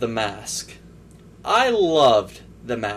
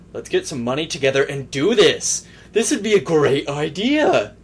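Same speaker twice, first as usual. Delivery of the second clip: building to a loud, mocking exclamation; hammed-up mock enthusiasm; overeager fans; 3 semitones higher, 5 dB louder than usual. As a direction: hype it up like a clueless fan pitching. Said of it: "They were like".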